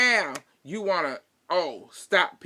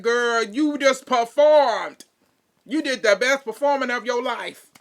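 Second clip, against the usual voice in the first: louder, higher mocking call-out, slowed; mocking outburst; 5 semitones higher, 6 dB louder than usual. Real, not acted. Mocking Kyle's fake tears to viewers, loud sarcasm.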